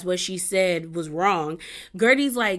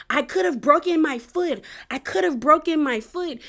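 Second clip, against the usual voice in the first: higher, mocking whine; whiny complaint; 7 semitones higher than usual. Adriana to the group, a mocking higher whine. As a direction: whine it like a dramatic victim. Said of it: "Adriana keeps saying".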